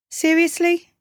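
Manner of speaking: high, smirking query; mocking, incredulous girl; smirking disbelief. A narrator's reading. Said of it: "she said with a smirk".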